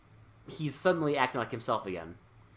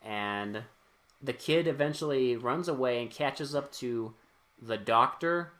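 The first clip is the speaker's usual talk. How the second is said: ordinary talk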